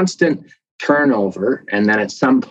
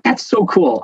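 geeky delight; 8 semitones higher than usual. Retold or imitated. Marvelling to himself about protein turnover, delighted. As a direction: pitch jumps higher, gushing. Retelling own delight at science.

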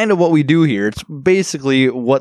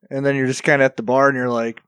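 ordinary delivery

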